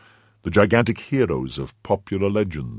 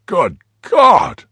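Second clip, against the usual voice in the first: high, loud exclamation; hollow-voiced cry; 10 semitones higher, 9 dB louder than usual. A narrator's reading. Man shut in the cellar hearing his friend, loud cry. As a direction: cry out from behind a closed door. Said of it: "cried the hollow voice of Athos".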